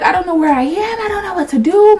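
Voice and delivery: high, rising helpless whine; mock tearful panic. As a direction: re-enact panicking on the phone. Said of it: "I'm crying".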